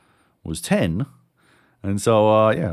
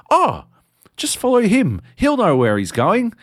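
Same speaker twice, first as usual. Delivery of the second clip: high mum voice; 9 semitones higher, 5 dB louder than usual. Retold or imitated. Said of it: "this voice from behind me goes".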